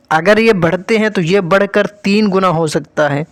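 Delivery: ordinary delivery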